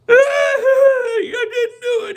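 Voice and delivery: put-on high, drawn-out wailing kid; mock crying. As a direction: bawl a denial like a spanked child. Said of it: "and he starts crying".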